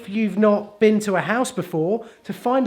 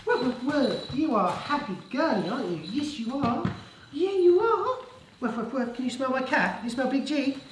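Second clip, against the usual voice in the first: doting dog talk; 7 semitones higher, 5 dB quieter than usual. Real, not acted. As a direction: coo playfully at a dog. Fussing over the dog.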